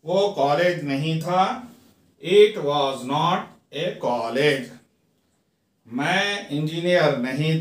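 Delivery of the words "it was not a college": very slow, each word apart; slow teaching read. Shown as a demonstration. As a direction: dictate it slowly to students. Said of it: "teacher repeats model sentence".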